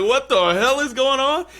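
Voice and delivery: high, loud what-the-hell; shocked disbelief. Retold or imitated. Narrator watching a brawl erupt, high and shocked. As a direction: react to chaos breaking out. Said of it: "I'm like".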